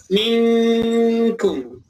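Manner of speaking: very slow minkum with the nun hummed like ng; drawn-out miŋkum; recited ikhfa; nasal hidden reading. Shown as a demonstration. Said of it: "lidahnya langsung ke huruf kaf".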